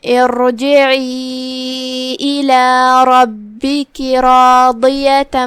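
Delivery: slow melodic Quran chant, long drawn vowels; tajweed recitation. Shown as a demonstration. Teacher recites the verses for the class, slow melodic chant. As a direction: recite each phrase slowly, stretching the madd.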